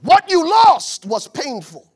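loud and slow; hard-hitting preaching line. Acted. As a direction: drive the point home slowly. Preaching peak.

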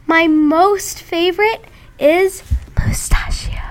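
slow secret whisper. Real, not acted. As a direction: whisper it so they can't hear. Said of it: "I have to whisper it".

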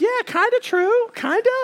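very high-pitched, squeaky mock musing; comic high-voiced hedging; half-convinced reaction. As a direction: pipe it up high like a tentative maybe. Acting out reading a fortune cookie.

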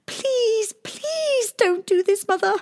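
high, slow desperate plea; tearful princess begging the queen; pleading. A narrator's reading. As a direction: beg her mother to stop. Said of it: "she pleaded".

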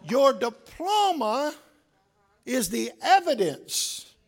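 slow, swelling sermon cadence; stately pulpit delivery; slow preaching. Acted. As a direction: address the graduates with weight.

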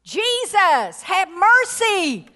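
high, drawn-out cry for mercy; high-pitched pleading call, imitating a desperate man; imitated scream. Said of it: "Those two men screamed at one point".

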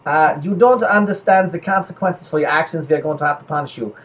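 put-on hesitant German officer's accent. Germans reluctantly warning of consequences, mild-mannered German accent. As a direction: lay down the rules in a mock German accent.

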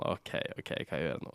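whispered, low "hva gjør jeg nå"; panicked whisper. Retold or imitated. Thinking to herself after the board's ultimatum, whispered.

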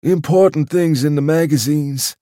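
a humble waiter speaking of his master; respectful old waiter. Acted. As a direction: explain it with awe for the master. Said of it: "said the waiter respectfully".

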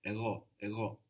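Ego with a soft G that hangs in the throat; Ego, the G soft and throaty, not a hard English G. Shown as a demonstration. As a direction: let the G in Ego stay soft and back in the throat. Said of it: "a soft G, which hangs around in the throat".

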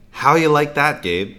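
mock yell across a room. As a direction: call it out to the whole class. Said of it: "yells across the room".

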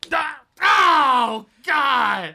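loud high cry of pain; pained scream. Acted. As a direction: howl as if slapped hard. Will getting slapped.

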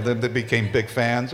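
ordinary talk, nothing marked

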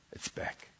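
whispered, defeated. Retold or imitated. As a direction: breathe it out in a whisper. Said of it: "She said".

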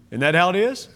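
ordinary talk, nothing marked

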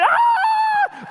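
very high, loud screaming cry; mock shriek of panic; a quick hammed-up scream; imitated girls' scream. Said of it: "the girls started screaming".

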